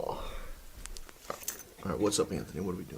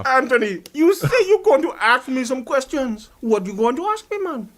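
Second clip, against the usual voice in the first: higher, pressing mock Jamaican patois; fake Jamaican accent; 7 semitones higher than usual. Guest pressing host for questions, fake Jamaican accent. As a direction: demand it in a put-on Jamaican accent. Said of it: "this is not your real accent".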